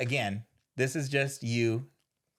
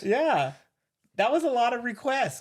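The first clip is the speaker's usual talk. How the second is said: much higher, louder teasing exclamation; excited; 10 semitones higher, 4 dB louder than usual. Real, not acted. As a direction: tease him, voice jumping up. Teasing about ass requests.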